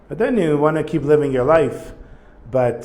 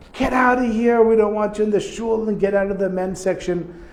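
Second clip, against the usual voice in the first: mock shouting; 8 semitones higher than usual. Man on the men's side ordering a woman out, mock shouting. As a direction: imitate an indignant yell in a raised pitch. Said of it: "starts shouting at her".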